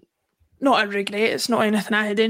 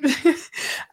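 short real chuckle; brief laugh between phrases; 5 semitones higher than usual. Real, not acted. Laughs while answering co-host's teasing.

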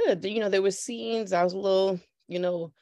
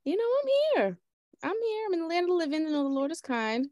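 church testimony; 8 semitones higher than usual. Acted. Guest's preacher-like testimony.

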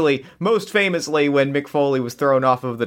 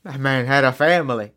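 J R impression. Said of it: "what's that J R is that wrestler announcer's name".

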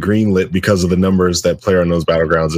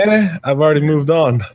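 bored dismissive; 6 semitones higher than usual. Retold or imitated. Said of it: "it's like".

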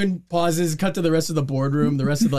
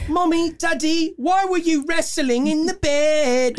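put-on higher, slow innocent child's question; mock child piglet; 11 semitones higher, slower than usual. Cohost playing Peppa asking her parents, mock childish. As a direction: ask it like a wide-eyed little piglet. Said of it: "The episode of Peppa Pig".

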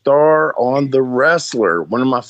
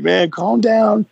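higher, slow soothing plea; playfully imitated peacemaker; mock calm-down plea; 12 semitones higher, slower than usual. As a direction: ease a hothead down, drawn out and high. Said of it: "and I'm like".

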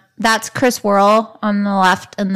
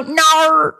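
"Nar" said in a mock accent, higher; 7 semitones higher, 4 dB louder than usual. Teasing about his usual accented nar. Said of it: "do your accent".

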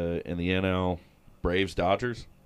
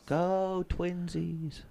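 mock cheer; 6 semitones higher, 6 dB quieter, much slower than usual. Mocking cheer for the Twins.

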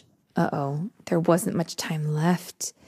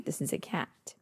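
ordinary delivery

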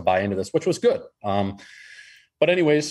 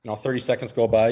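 normal talking voice

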